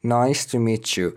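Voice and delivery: "meet you" blended as "meechu"; ch sound. Shown as a demonstration. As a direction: slowly join "meet you" with a ch. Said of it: "ما صدای چ داریم". («meet you» با صدای چ)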